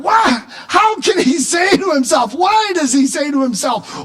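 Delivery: high, incredulous "why"; impassioned, protesting questions; indignant questioning. Acted. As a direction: demand an answer in disbelief. Acting out David's indignant questions.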